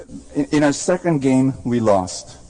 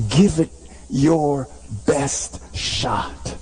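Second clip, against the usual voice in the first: slow, drawn-out; 2 semitones higher, much slower than usual. Acted. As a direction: lay the words down heavy and slow. Slow, drawn-out, low closing line.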